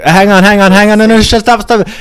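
high, loud hammed-up yell of hang on; mock urgent shout. Retelling the movie, Nelson yelling at friends to stop, hammed-up high shout. Kiefer's character halting his friends.

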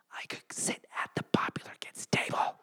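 breathy hushed daydream; whispered dream. Retold or imitated. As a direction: whisper it like a secret wish. Dreamy aside about popular table.

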